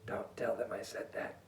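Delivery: hushed conspiratorial whisper; very soft, low, voiceless aside; whispered secret. Pretend. Secret aside about annoying family.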